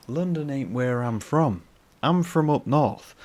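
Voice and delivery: put-on slow, loud northern English accent, stretching the words. Proudly telling viewers where he's from, in a slow northern English accent. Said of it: "That's how they sound".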